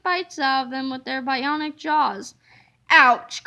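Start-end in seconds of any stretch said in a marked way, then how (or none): 2.9-3.4 loud sudden ouch; sharp pained yelp; cry of pain. A narrator's reading. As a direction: yelp as if bitten. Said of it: "cried the Turbo Toilet two thousand".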